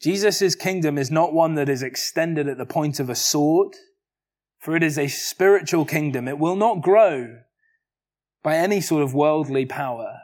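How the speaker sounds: usual speaking voice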